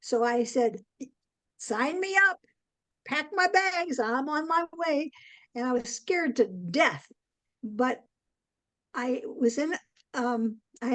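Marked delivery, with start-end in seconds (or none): 1.6-5.1 higher, bright eager burst; eager excitement. Retold or imitated. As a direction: jump at the chance, raring to go. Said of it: "I was just so excited".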